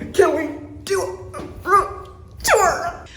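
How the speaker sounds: slow, high, word-by-word delivery; drawn-out excited question. Real, not acted.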